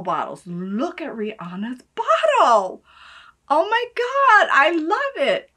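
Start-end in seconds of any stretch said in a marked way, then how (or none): 3.5-5.5 high loud delighted squeal; thrilled high exclamation; excited squeal. Real, not acted. Squealing over bottle.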